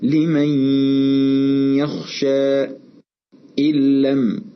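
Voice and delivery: slow tajweed demo of "لمن يخشى" with held nasal hum, then "إن لم" merged without it; careful recitation demo. Shown as a demonstration. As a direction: recite the examples slowly and distinctly. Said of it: "والتركيز على كيفية نطق".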